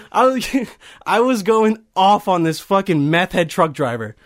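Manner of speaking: ordinary talk, nothing marked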